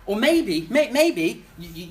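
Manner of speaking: normal talking voice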